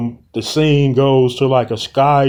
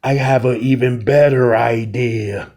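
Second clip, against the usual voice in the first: understated menacing queen; vampire queen. Acted. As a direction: savour the threat like a predator. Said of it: "vampire queen ... is like".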